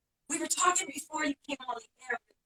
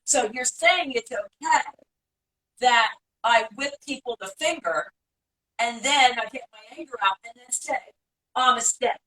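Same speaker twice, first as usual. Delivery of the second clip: louder, more emphatic restatement; repeating on request; 6 dB louder than usual. Real, not acted. Host repeats the question for the guest, louder and more deliberate. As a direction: say it out loud and firmly, phrase by phrase. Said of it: "could you repeat that again".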